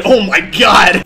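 slow, drawn-out exasperated cry; exasperated outburst. Real, not acted. Gamer answering a jab, exasperated and loud.

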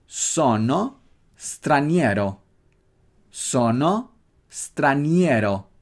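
Sono straniero said slowly, every letter as written; slow syllable by syllable; spelled-out pronunciation. Shown as a demonstration. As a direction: pronounce straniero exactly as spelt. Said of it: "Tal cual se escribe, tal cual se pronuncia".

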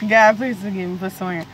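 usual speaking voice

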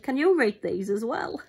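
a hint of a jokey paramedic asking the dog; paramedic joking to the dog. Paramedic teasing the dog he's kneeling beside, a light mock-serious voice. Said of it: "he just turned round and looked at her and said".

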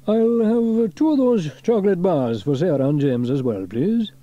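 cheerful shop order; Fireman Sam. A narrator's reading. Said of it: "he said".

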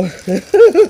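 high, loud burst of laughter; giddy laugh. Real, not acted. Collector thrilled by perfect paintwork, high giddy laugh. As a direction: laugh out with pure glee. Giddy over Michelangelo figure.

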